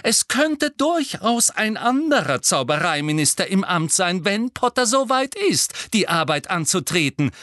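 heated, emphatic cry; calling out. A narrator's reading. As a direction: fire back loudly over an opponent. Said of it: "rief Professor McGonagall".

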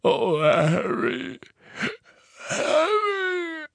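high slow crying giant; sobbing. Acted. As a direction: weep over the fallen boy. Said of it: "schluchzte Hagrid".

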